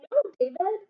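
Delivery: high sing-song scold. Shown as a demonstration. Teacher modelling a scold for the class. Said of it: "Tell him".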